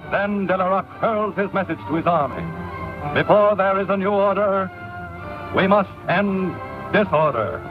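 vintage 1930s newsreel narration. Real, not acted. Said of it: "This is a newsreel from the early nineteen thirties".

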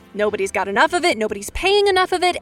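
usual speaking voice

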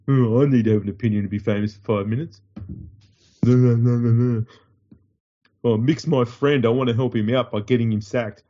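mock pundit voice, put on and sneering; mocking Tallis. Mocking Tallis to his co-host, a put-on sneer. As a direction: parody a pundit chasing fame. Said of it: "Tallis opened his fat mouth".

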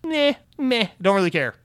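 high, bored "meh, meh" shrug of a voice; mock indifference. Podcaster mocking games media's shrug, in a high bored voice. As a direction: lazily brush it off, mocking the media. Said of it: "the games industry and the games media are just like".

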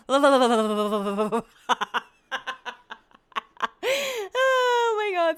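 a high, laughing oh my God. Real, not acted. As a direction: crack up on it. Laughing at her own joke.

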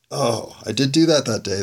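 normal talking voice

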